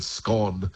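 SCONE rhyming with gone; short-O scone. Shown as a demonstration. Word expert citing the old Gaelic word, short-O SCONE. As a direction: say SCONE as "skon". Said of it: "a sort of Gaelic word".

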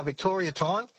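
normal talking voice

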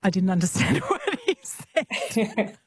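words broken by a real laugh; laughing while talking. Real, not acted. Radio host amused by the Billy Connolly clip, laughing. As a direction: laugh through the line, amused.

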